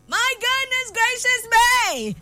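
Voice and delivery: high, slow and drawn out; excited exclamation. Real, not acted. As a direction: savour every word with delight. Excited birthday morning exclamation.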